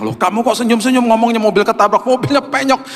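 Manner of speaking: high, puzzled wife voice; wife puzzled by her smiling husband, high voice; wife's puzzled question. Retold or imitated. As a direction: ask why he's grinning about a wrecked car. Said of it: "dia bilang".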